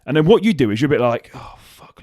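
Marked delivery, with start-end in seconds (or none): 1.3-2.0 mock panicked whisper under the breath; whispered oh fuck. Coach voicing his own inner panic, whispered.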